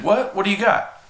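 quick, high excited question; eager kid. Retold or imitated. Kid hearing about boards in the trunk, eager. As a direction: perk up like a curious kid.

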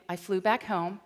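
ordinary talk

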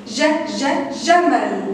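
ج repeated alone as a short syllable, then جمل whole; first sound isolated. Shown as a demonstration. Teacher showing pupils the opening sound, first sound isolated. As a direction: sound out ج، ج before saying جمل. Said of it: "الصوت الأول اللي أنا بقوله".

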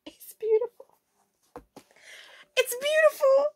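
very high, emotional squeak; overcome with delight. Real, not acted. Vlogger overwhelmed by her new shoes, high squeaky delight.

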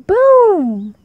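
big, loud drawn-out boom; booming crash. A narrator's reading. Narrator voices the great crash, booming loudly.

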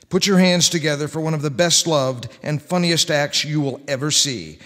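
usual speaking voice

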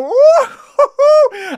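a long, high, loud laughing whoop; excited whoop. Real, not acted. Reactor whooping to viewers about the rap battle. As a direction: woo like you can't contain the hype.

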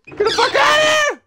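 high, loud yelling; loud shout. Real, not acted. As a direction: scream at the attacker. Attacked by enemy in game.

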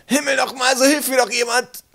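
high exasperated cry for help; desperate call. A narrator's reading. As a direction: call out high and loud for someone, anyone. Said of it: "rief die Stimme wieder".

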